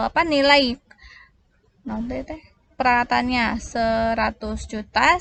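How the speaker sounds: ordinary delivery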